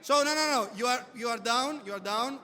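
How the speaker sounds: usual speaking voice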